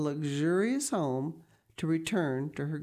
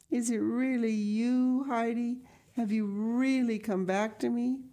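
put-on higher-pitched, wondering old grandmother; grandmother, joyful disbelief; 7 semitones higher than usual. Blind grandmother asks returning Heidi, joyful disbelief. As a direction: ask it high, scarcely believing.

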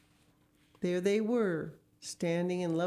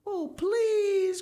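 high, loud, desperate wail; frantic mother begging, very high; pleading cry; 6 dB louder than usual. A narrator's reading. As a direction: beg at the top of your voice. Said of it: "cried Missus, Missus Gregg".

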